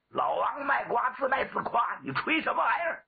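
high, quickening rebuke; exasperated scolding. Acted. Grandfather hears his grandson boasting, exasperated high scolding. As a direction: rise and speed up as you scold.

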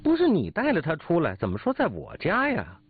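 high-pitched heated protest; accusing retort, pitched well up; flustered anger. Acted. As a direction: argue back red-faced and indignant. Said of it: "保父涨红了脸".

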